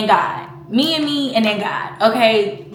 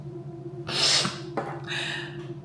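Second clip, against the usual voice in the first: tearful sigh; 10 dB quieter than usual. Real, not acted. Holding back tears.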